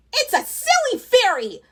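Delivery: high squeaky goblin cry. Acted. Goblin in fuzzy mittens cries out at the fairy, high and squeaky. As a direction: shriek it like an alarmed goblin. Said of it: "a goblin with fuzzy mittens cried".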